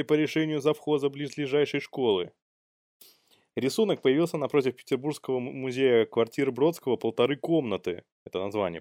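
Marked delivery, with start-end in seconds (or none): none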